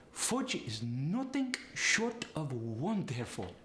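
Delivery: drawn-out, sing-song Polish accent. Shown as a demonstration. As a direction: relish it slowly in a Polish accent. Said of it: "the uh sound goes to the oo sound".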